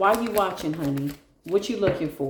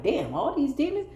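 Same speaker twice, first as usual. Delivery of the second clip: higher, startled "Damn"; mock surprise; 8 semitones higher than usual. Seeing the demons drop, a higher mock-startled exclamation. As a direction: act amazed at how many there are. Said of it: "You like".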